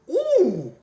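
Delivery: loud high crowd ooh; a big shocked crowd ooh. Retold or imitated. Whole arena reacting to the slam, loud ooh.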